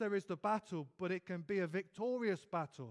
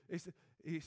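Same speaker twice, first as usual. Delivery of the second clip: ordinary talk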